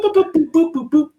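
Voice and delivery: high sing-song boops. Shown as a demonstration. Imitating the keypad tones.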